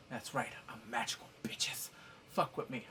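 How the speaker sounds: low, hushed put-on voice; mock demon baby. Newborn boasting it is magical, low and hushed. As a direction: sink low and soft, nearly whispered.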